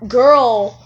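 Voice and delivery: ordinary delivery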